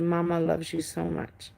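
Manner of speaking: soft, tender hushed mama voice; softly to a child you're hugging tight. Retold or imitated. Mom hugs daughter tight.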